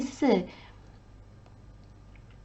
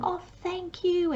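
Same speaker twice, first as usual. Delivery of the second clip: grateful customer; 10 semitones higher than usual. Retold or imitated. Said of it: "they're going".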